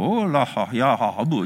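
mock leering hoots from men ogling; hammed-up ogling noises, half-laughing; leering guys' noises. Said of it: "they'd be walking along and they go".

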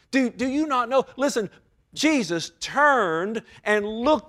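high, loud, driving sermon voice; preaching at full force. Real, not acted. Preacher pleading with sinners in church, loud and high. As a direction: pound the point home to the congregation.

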